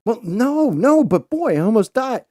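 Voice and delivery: high loud frantic insistence; panicked whine. Retold or imitated. Father insisting to son he almost died, high and frantic. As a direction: squeal it out way up high.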